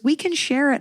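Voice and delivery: high bright offer to share; mock cheery mother; chirpy other mom. Quoting other mom offering bear.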